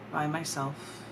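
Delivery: ordinary delivery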